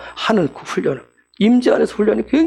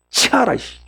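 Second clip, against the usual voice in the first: mock angry God; 2 semitones higher than usual. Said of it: "하나님 진노했다고 그랬어요".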